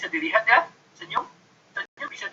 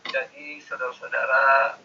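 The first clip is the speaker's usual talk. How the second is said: lightly played hesitant speaker, lower; unsure lecturer; 4 semitones lower than usual. Shown as a demonstration. Lecturer acting out an unsure speech, mildly hesitant. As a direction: mumble as if unsure. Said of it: "Cocok kan ucapan saya".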